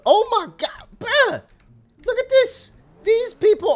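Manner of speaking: squeaky high, loud astonishment; can't believe what he sees; shocked disbelief. Real, not acted. Shocked by crazy drivers.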